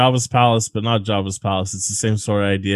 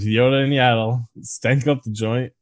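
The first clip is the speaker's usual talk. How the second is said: ordinary delivery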